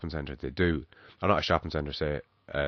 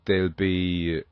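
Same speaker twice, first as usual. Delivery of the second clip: usual speaking voice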